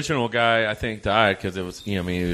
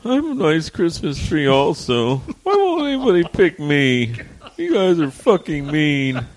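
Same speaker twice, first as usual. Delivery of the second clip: high, whiny hurt Christmas tree; hammed-up pouty tree, higher; sad Christmas tree; 7 semitones higher than usual. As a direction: whine like the tree nobody picks. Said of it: "be like the last Christmas tree".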